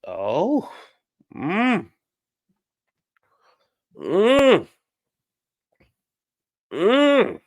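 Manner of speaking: high swooping "oh" and rising "mm"; drawn-out, high-pitched reaction noises; scandalized oohs and hums. Real, not acted. Vlogger reacting to a raunchy joke, scandalized high oohs.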